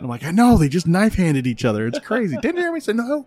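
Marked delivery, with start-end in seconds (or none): 0.2-2.4 excited. Retold or imitated. Dad telling wife, amused. 2.4-3.3 son's voice. Retold or imitated. Re-enacting son's line.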